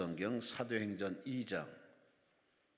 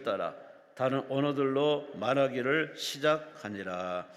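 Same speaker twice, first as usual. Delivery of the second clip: measured, sustained scripture recitation; scripture reading; 4 semitones higher, 9 dB louder than usual. Acted. Leader reads Acts aloud with the congregation, firm scripture recitation. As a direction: read it out firmly for the congregation to follow.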